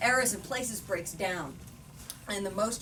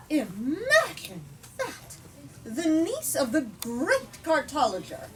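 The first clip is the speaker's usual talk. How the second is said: impressed; 4 semitones higher, 4 dB louder than usual. Acted. Spectator impressed by Sophia's uncle.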